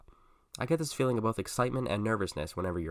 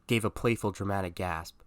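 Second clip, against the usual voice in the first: usual speaking voice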